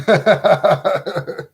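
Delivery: rippling, repeated chuckle; real laugh. Real, not acted. Teacher finds themself bleeding mid-lesson, laughing. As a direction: laugh it off in a quick run of ha-has.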